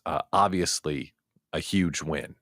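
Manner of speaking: ordinary talk, nothing marked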